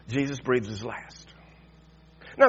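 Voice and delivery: ordinary talk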